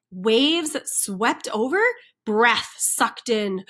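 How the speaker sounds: usual speaking voice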